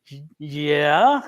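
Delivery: low, hesitant, questioning yes; wary confirmation. Retold or imitated. Answering the trademark officer's question, wary. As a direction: imitate admitting it cautiously, stretched upward.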